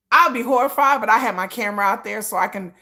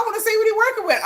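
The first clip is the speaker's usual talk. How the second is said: high, fast, nosy eagerness; eager mock aside; 10 semitones higher, faster than usual. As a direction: blurt out the nosy wish. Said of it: "So I'll be like".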